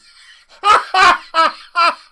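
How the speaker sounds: shrieking mocking laugh; loud high cackle. Real, not acted. Laughing at the report's wording in a loud shriek.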